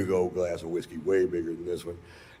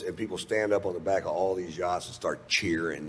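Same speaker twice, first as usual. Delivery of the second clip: ordinary delivery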